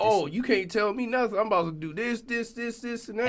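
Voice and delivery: put-on defiant teenager. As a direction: sass it like a know-it-all teen. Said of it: "it's like".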